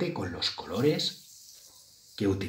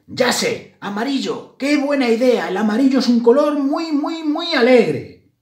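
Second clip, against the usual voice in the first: high, loud, excited mouse, building; excited idea; 14 semitones higher, 7 dB louder than usual. A narrator's reading. Mouse Grisela hits on yellow as her colour, excited. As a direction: build to a squeal, stretching the last words.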